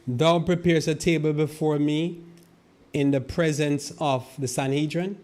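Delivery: preaching the verse. Shown as a demonstration. Preacher expands the table verse to his flock, high and loud. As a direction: build it up and proclaim it. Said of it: "verse reread, expanded".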